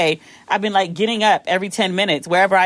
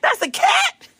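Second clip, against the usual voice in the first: very high, laughing incredulous squeak; can't believe it's a cat; shrill laughing disbelief; squeaky disbelief; slower than usual. Retold or imitated.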